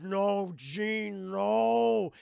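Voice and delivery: loud, drawn-out despairing cry; hammed-up grief-stricken plea; mock anguished wail. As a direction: wail it melodramatically. Fred wails over Jean.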